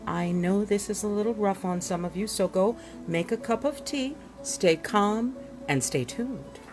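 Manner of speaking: normal talking voice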